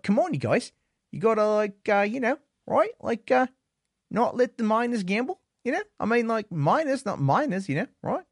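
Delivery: put-on high Australian accent that slides toward Kiwi; attempted Australian accent. Streamer scolds the Sydney casino in a bad Australian accent. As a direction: chide the casino in a hammed-up Australian voice. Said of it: "I don't know if I can do it in Australian".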